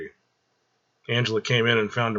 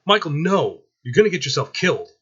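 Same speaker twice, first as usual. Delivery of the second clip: higher, louder frightened cry; panicked plea; 6 semitones higher, 4 dB louder than usual. Acted. Mother begs her son not to fight the gang, panicked. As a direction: stop him in a panic. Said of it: "Angela panicked".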